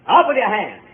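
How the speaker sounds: normal talking voice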